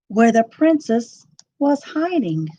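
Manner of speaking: ordinary delivery, nothing marked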